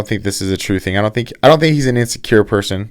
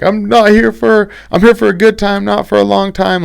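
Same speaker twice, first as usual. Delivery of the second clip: much higher, slowed mock voice of a carefree young fighter; high-pitched mock of a young fighter having fun; imitating Izzy; 12 semitones higher than usual. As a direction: ham up the cocky kid on the mic, up high. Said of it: "being like".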